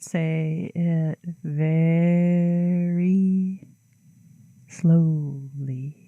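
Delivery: drawn-out slow words. Shown as a demonstration. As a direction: stretch each word out long. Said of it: "you say 'em really long and drawn out".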